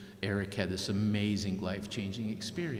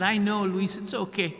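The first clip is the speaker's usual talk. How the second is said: high, gentle reassurance, lightly voiced; soothing little girl; 9 semitones higher, 5 dB louder than usual. Girl reassures Luis after nearly dying, high and gentle. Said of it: "She said".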